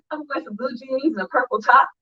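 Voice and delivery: put-on a touch of a breezy teen; mimicking girl. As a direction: lightly play the girl again. Mimicking the girl's answer.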